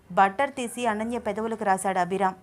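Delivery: ordinary delivery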